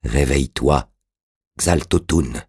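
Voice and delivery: slowly, with a pause before the name; slow command. A narrator's reading. Orastès calls the dead magician to wake, slow and deliberate. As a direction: draw out a measured summons. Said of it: "Puis il dit lentement".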